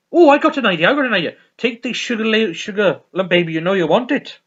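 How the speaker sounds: acted giddy enthusiasm; excited brainwave. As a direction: blurt out a sudden idea. Acted excited songwriting idea from sitcom.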